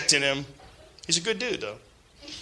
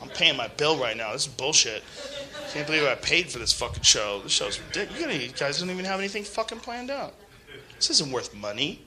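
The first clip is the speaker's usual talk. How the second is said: mock bored complaint, softer; grumbling audience member; 3 semitones lower, 8 dB quieter than usual. Imitating a fed-up listener complaining about the show, quietly grumbling. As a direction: mutter like a fed-up paying customer. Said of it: "Some of you are like".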